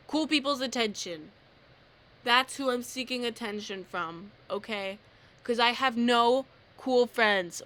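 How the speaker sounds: loud joking defiance; mock-defiant loud. Real, not acted. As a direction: declare it loudly, half joking.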